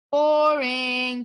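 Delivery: loud bored shout from across the cave; shouted boring. A narrator's reading. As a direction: call it out loud, fed up. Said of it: "Clay shouted from his pile".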